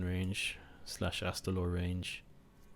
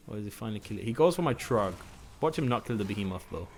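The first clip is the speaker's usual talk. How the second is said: ordinary talk, nothing marked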